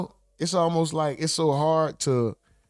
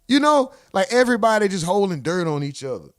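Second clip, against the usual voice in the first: normal talking voice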